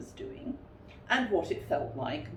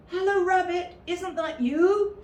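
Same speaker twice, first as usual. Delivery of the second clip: higher, louder hopeful call; Pooh's voice; 12 semitones higher, 7 dB louder than usual. Acted. Pooh calling to Rabbit inside, higher and louder. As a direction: call in brightly.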